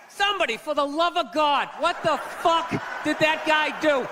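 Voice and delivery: normal talking voice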